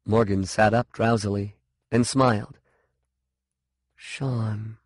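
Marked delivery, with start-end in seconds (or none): 3.9-4.8 quiet and sleepy; murmured. A narrator's reading. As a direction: murmur the name drowsily. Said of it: "she murmured".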